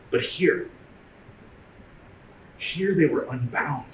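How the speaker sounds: measured spoken-word reading with weighted pauses; poem recitation. Acted. Artist in residence reading a poem for teachers, spoken-word recitation. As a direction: recite it like spoken-word poetry, swelling on key lines and dropping at line ends.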